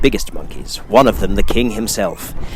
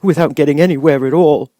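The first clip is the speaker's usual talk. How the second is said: whimpering lion; 8 semitones higher, 4 dB louder than usual. A narrator's reading. Timid lion to friends, stammering whimper. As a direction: stutter it out, timid and whining. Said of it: "with a whimper".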